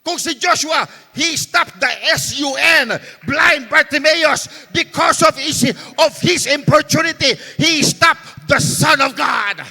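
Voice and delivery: loud, fervent, sing-song preaching; preaching at full force. Acted. Pastor urging the church to keep praying, fervent shouted preaching. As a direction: drive it home to the congregation.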